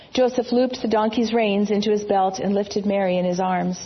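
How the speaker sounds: usual speaking voice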